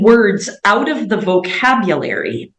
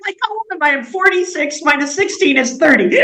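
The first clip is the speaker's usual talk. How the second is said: counting it out much higher, still amused; 6 semitones higher, faster than usual. Real, not acted. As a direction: work out your age while still laughing. Doing her age math after laughing.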